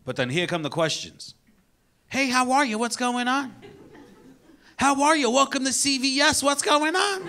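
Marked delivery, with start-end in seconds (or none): 2.1-7.3 chirpy cashier. Retold or imitated. Cashier greeting customer.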